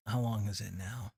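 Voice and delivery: ordinary delivery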